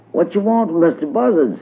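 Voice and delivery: high put-on voice; imitating Brer Fox. A narrator's reading. Mister Buzzard answers Brer Rabbit, imitating a fox in a high voice. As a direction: pitch it up, posing as someone else. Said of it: "tried to talk like Brer Fox".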